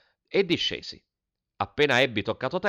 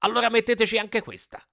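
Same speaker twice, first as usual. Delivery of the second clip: high-pitched yell of outrage; angry shout; 12 semitones higher, 4 dB louder than usual. A narrator's reading. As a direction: shout it down at them, pitch soaring. (urlo indignato)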